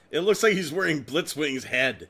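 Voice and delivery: normal talking voice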